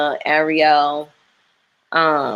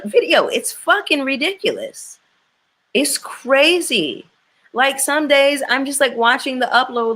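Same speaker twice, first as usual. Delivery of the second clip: high-pitched frustrated rant; 8 semitones higher than usual. Real, not acted. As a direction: voice up high, fed up and exasperated. Ranting about slow uploads.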